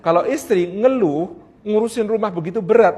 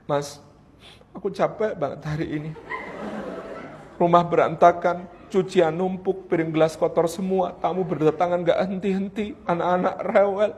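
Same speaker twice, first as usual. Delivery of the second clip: mildly weary wife, lower and softer; a tired wife's quiet grumble, acted; istri mengeluh; 3 semitones lower, 6 dB quieter than usual. Istri curhat capek kepada suami. (keluhan istri yang agak lelah)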